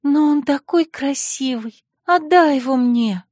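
breathy whisper. Acted. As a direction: sigh it out, smitten. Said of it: "с придыханием прошептала". (восторженный шёпот с придыханием)

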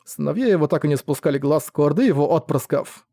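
ordinary delivery

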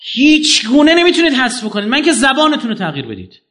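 emphatic retold declaration. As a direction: declare it loudly to the hall. Retelling his own emphatic lecture.

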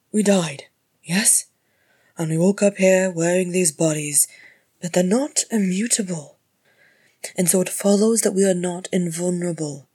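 loud, emphatic explaining; exasperated lecture. A narrator's reading. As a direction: hammer each point home loudly. Healer lectures patient after loud sigh.

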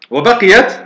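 normal talking voice

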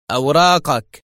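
loud, curt demand; rough and harsh. A narrator's reading. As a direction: order it harshly, like a checkpoint officer. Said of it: "في لهجة خشنة قاسية".

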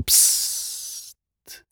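soft unvoiced shush for silence; quiet psst. A narrator's reading. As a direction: hush the kids with a finger to the lips. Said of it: "przytknął palec do ust i powiedział".

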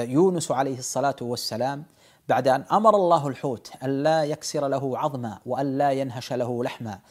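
ordinary talk, nothing marked

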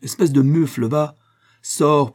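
loud, high burst of anger; furious dismissal; angry insult. A narrator's reading. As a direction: hurl the insult and order them out. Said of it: "en colère et frappant du poing".

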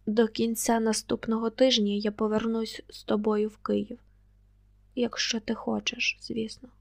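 usual speaking voice

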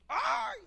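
high drawn-out "ay" at normal volume; imitated cry of pain. Preacher mimics a patient as the sore is dug out, pained cry. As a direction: yelp a long, high ouch. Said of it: "El grito".

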